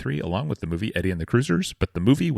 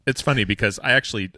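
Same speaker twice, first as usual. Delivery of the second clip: ordinary delivery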